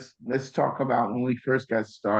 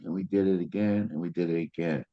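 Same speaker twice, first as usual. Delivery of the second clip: ordinary talk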